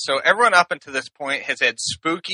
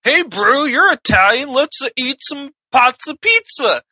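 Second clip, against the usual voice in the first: acted quote; 8 semitones higher than usual. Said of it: "He says".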